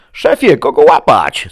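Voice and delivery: loud, high call to the boss; urgent shouted question. A narrator's reading. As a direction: shout for orders mid-chase. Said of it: "spytał Jim". (głośne, naglące wołanie)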